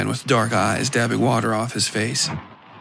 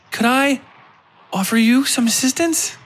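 much higher, sweet and teasing; coy young lady Moira; 14 semitones higher, 3 dB louder than usual. A narrator's reading. Moira teasing the foreigner, offering help in a sweet high voice.

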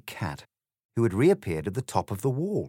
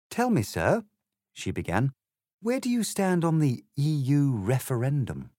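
put-on a slightly higher, prim girl's voice; polite Alice, lightly voiced; 6 semitones higher than usual. Alice politely answers the egg, lightly voiced girl. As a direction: explain it courteously, a proper little lady.

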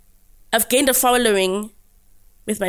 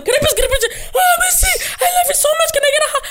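high, gushing fangirl voice, much higher; gushing fans; much faster than usual. Retold or imitated. Group of fans begging for pictures and hugs. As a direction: beg for a hug, starstruck. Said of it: "Now the whole group of them".

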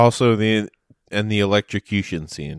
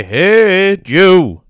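calling out; 7 semitones higher, 8 dB louder than usual. Pretend. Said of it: "Imagine trying to say".

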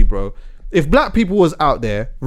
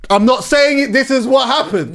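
emphatic; 10 semitones higher than usual. Real, not acted. Emphatic disclaimer.

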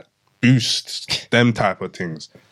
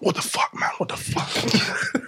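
stunned whisper; 12 semitones higher, 5 dB quieter, slower than usual. Retold or imitated. Shock after crash.

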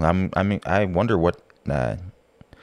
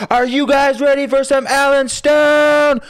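much higher, louder, far bigger hype call; hype man shout; 7 dB louder than usual. Pretend. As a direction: go nuts hyping the crowd. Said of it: "I'm trying to go nuts".

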